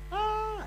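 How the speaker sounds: high startled scream. Retold or imitated. Dad scaring the kids with chicken feet, a high scream. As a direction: yelp again, high and sharp. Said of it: "I scream".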